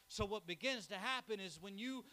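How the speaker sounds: usual speaking voice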